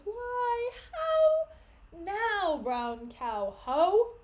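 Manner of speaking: high loud "how now, brown cow"; playful loud rhyme; mock booming entrance. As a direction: burst in hamming it up. Joking Othello entrance line.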